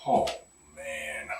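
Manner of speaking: usual speaking voice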